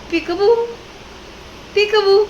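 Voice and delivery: high squeaky peekaboo call; peekaboo sing-song. Pretend. Mother playing peekaboo with her toddler, high and squeaky. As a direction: play peekaboo with the baby.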